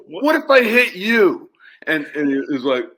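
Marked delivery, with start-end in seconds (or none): 0.1-1.5 slow, louder threat; annoyed homeowner warns the golfer; threatening. Retold or imitated. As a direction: lay it on him slowly. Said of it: "And I said".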